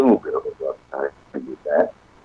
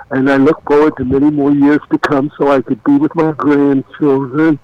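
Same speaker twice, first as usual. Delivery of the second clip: high-pitched mock old-timer voice; jokey high old person's voice; put-on senior citizen; 10 semitones higher than usual. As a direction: play up being elderly in a much higher voice.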